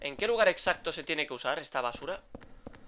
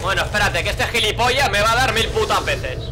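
raging shout, higher and louder; furious yelling; 3 semitones higher, 9 dB louder than usual. Real, not acted. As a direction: scream at the enemy in real fury. Repeatedly hit by an enemy.

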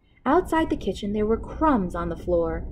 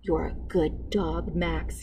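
softer, slow hushed praise; whispered; 4 semitones lower, 7 dB quieter, slower than usual. A narrator's reading. Cook whispers praise to his dog outside the king's door. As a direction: hush it by the keyhole. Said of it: "he whispered".

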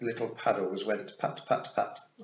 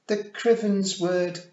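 put-on high, loud, slow child's voice; drawn-out toddler recital; muttering little boy; 11 semitones higher, 9 dB louder, slower than usual. A narrator's reading. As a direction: reluctantly recite like a small child. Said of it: "Wentworth muttered".

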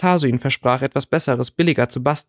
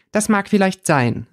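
defiant; 6 semitones higher than usual. A narrator's reading. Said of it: "erwiderte Theresa trotzig".

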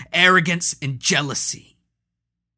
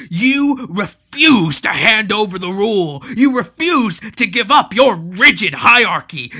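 mocking; 11 semitones higher, 12 dB louder than usual. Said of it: "Hanno cackled".

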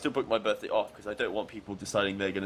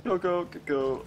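urgent excited; 8 semitones higher than usual. Real, not acted. Urging teammate on in game.

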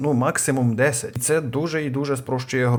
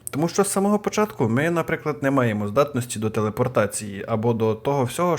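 ordinary delivery

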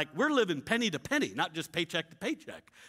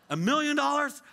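high, louder incredulous echo; stunned disbelief; 8 semitones higher, 4 dB louder than usual. Retold or imitated. Pastor stunned by a million-dollar offer, incredulous. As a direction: gasp the number out.

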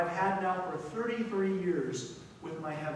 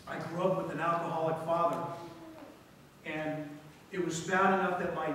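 ordinary delivery

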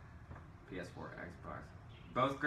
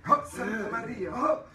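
exaggerated Spanish accent, high and loud; hammy Spanish guy speaking English; 12 semitones higher, 5 dB louder than usual. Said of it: "a Spanish guy, like, talking in English".

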